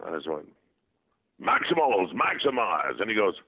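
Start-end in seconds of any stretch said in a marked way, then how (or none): 1.4-3.0 higher, ringing battle command; rally the troops like a robot leader; performed catchphrase; Optimus. Acted. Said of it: "say an Optimus thing".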